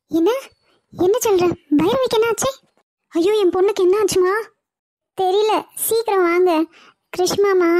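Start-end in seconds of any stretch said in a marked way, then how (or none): 0.1-6.5 high, frightened cries; a mother panicking for her daughter; alarmed mother. Acted. Grandmother hears daughter collapsed. (பயந்து பதறும் அம்மா குரல்)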